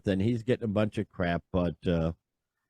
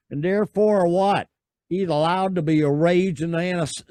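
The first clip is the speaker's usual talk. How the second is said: higher, put-on Southern accent; 6 semitones higher, 4 dB louder than usual. Reading Draymond's reply aloud, mock Southern accent. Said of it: "I'm gonna use my Southern accent".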